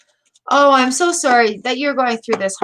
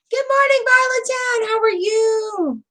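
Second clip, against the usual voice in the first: sing-song greeting; 10 semitones higher than usual. Acted. Greeting Violet Town in chat, high sing-song. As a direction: croon a cheery hello way up high.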